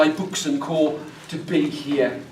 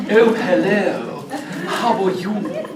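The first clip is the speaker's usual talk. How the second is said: a light, slow imitation of the Queen's posh English; lightly mock the Queen's posh English greeting; a hint of posh English, drawn out; posh English accent; slower than usual. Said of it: "don't speak like the Queen".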